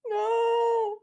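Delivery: sudden high scared yelp; frightened cry. Real, not acted. Streamer yelps at a jump scare, frightened.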